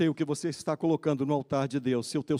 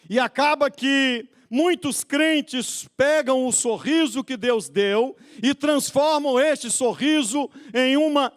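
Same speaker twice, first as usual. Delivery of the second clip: high, slow, stretched-out preaching; full-force preaching; 9 semitones higher, 6 dB louder, slower than usual. Real, not acted. Preacher warning believers about idolatry, full-force preaching. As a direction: drive it home from the pulpit.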